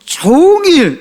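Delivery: 종일 drawn out high on a held note; stress on 종일. Shown as a demonstration. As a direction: stretch 종일 to sound like all day long. Stresses key word of verse.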